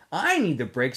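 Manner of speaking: usual speaking voice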